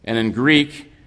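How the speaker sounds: ordinary delivery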